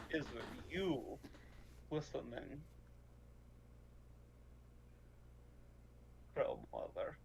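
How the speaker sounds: hushed address. Acted. Player addressing Crow Mother through the bird, low and hushed. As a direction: sink into a low, hushed question.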